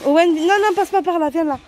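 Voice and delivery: loud call to her son. Real, not acted. Mother calls child away. (appel fort à son fils)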